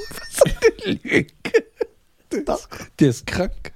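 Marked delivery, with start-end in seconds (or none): 0.0-1.3 squeaky laughing disbelief. Real, not acted. Laughs at the praise.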